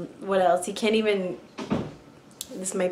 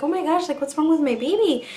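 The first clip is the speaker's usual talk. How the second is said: worried; 8 semitones higher, 3 dB louder than usual. Retold or imitated. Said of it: "I was like".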